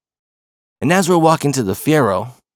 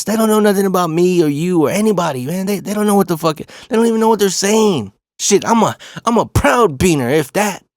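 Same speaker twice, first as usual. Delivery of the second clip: high-pitched, emphatic dismissal; retold defiance; 7 semitones higher than usual. Dad telling his upset son the bullies know nothing, defiant. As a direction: act out a dad brushing off the insults, pitch up.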